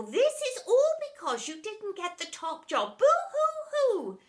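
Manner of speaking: high, loud taunting; mocking. A narrator's reading. Teacher taunting the deputy, high and loud. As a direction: taunt her with fake pity. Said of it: "mocked Miss Epoch".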